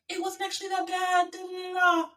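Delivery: high-pitched dismissive parroting; mocking the doubters. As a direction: parrot them on one high, level pitch. Mocking people who downplayed the drivers.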